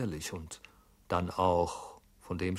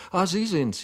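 surprised greeting; 10 semitones higher, 7 dB louder than usual. Acted.

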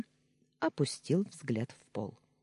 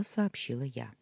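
ordinary delivery, nothing marked

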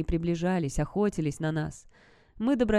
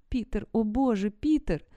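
distressed exclamation; 4 semitones higher than usual. A narrator's reading. Horrified Sarah realizing Peter's fate.

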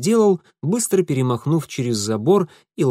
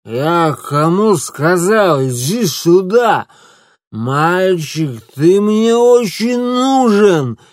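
put-on slow, loud, slurred drunk demands; drunk slurring; 5 semitones higher, 7 dB louder, much slower than usual. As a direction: drag out every word like a drunk man. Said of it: "заплетающимся языком".

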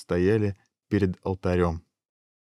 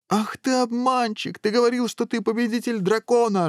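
angry accusation. A narrator's reading. King shaking fists at the hero, high-pitched outrage. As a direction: wail the accusation at the top of the range. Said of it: "рассердился король".

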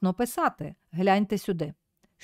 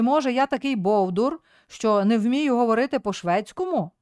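ordinary delivery

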